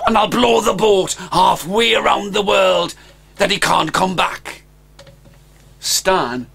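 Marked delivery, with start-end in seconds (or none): 0.1-4.6 higher, eager plotting; a young dragon hatching a plan; scheming dragon boy. Acted. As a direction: lay out the plan with relish.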